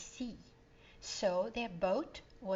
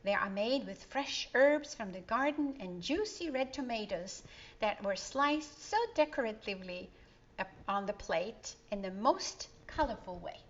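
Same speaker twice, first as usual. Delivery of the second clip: usual speaking voice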